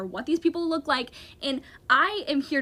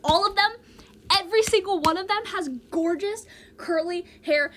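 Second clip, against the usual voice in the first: louder and higher, indignant; outraged rant; 7 semitones higher, 4 dB louder than usual. Real, not acted. Ranting to viewers about curly-haired cast members, loud indignant outburst. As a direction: throw up your hands at the unfairness.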